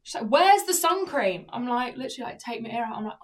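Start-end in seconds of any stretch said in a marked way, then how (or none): 0.3-1.5 high, loud, slow imitation of a shopper; hammed-up insistent question; demanding customer. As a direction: demand it as a pushy customer would.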